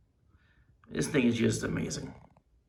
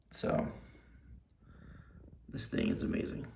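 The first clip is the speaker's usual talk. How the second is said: whispering; 12 dB quieter than usual. Real, not acted. Awed by the figure.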